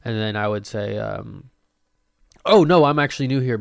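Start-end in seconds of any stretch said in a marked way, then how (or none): 2.4-3.6 loud and quick, brushing it off; loud fast reply. Pretend. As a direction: blurt it out fast. Acted reply to church.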